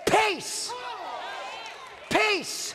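commanding shout. Acted. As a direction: command "Peace, peace," at the storm. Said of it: "And then he shouted".

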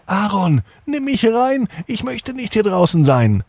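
very high, tiny raindrop pleading at the window; small raindrop asking to come in. A narrator's reading. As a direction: pitch way up like a little drop of rain. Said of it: "sagte zum Beispiel der erste Regentropfen".

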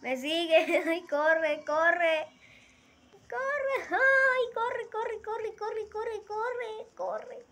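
panicked high screaming; yelling while fleeing in panic; being chased. Real, not acted. Fleeing a monster in game.

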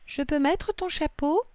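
put-on high-pitched little girl's request; child asking. A narrator's reading. Lily asks to borrow mum's hat, child asking. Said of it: "demande Lily".

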